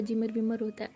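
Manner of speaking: plain reading voice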